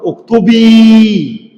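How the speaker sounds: "اكتبي" stretched slowly, the final ي held long; drawn-out teaching demo. Shown as a demonstration. Teacher showing students the added ya, slow stretched demo. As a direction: spell out "اكتبي" slowly, holding the ياء. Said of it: "إذا أدخلت عليه الياء تقول".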